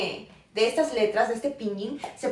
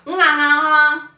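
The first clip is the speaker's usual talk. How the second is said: loud, higher drawn-out mock Cantonese; imitating Cantonese tones; 5 semitones higher, 9 dB louder, much slower than usual. Imitating elderly Cantonese speakers using only tone, loud mock Cantonese. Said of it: "utilizan un tono como".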